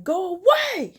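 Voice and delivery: loud, high-pitched yell; angry shout. Retold or imitated. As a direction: scream at them through the door. Repeats dismissal through the door.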